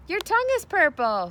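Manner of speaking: put-on high, loud childish scream. A narrator's reading. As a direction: shriek it at your brother. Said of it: "screamed Fern".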